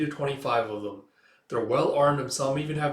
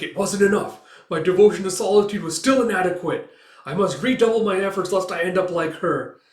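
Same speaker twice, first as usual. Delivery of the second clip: higher, louder self-reproach; grim vow; 6 semitones higher, 8 dB louder than usual. Acted. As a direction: swear to himself to try harder. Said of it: "he's like".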